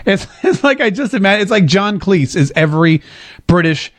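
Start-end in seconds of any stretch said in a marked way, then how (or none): none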